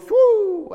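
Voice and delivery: high, sustained whoosh of a flying arrow; high "whoo" sound effect; arrow whistle imitation. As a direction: make the sound of an arrow sailing past.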